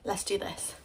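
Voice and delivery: usual speaking voice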